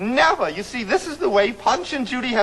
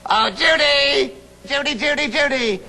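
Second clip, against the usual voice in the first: Punch puppet voice; 4 semitones higher than usual. Acted. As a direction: call your puppet partner in a playful show voice. Performs Punch in puppet show.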